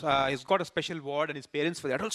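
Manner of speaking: ordinary talk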